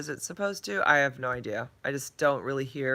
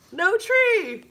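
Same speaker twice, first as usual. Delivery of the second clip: excited; 8 dB louder than usual. Real, not acted. Delighted tree is gone.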